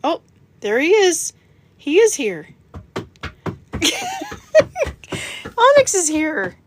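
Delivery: light up as he walks in; excited greeting. Real, not acted.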